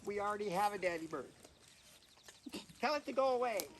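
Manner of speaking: high little nestling voice, a bit fast; fussy fledgling shooing a stranger; baby bird voice. Acted. Baby ravens reject Colin.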